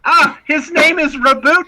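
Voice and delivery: high, loud mock indignation; hammed-up outrage at the name; mock outraged correction. Host corrects the trailer's name in hammed-up outrage.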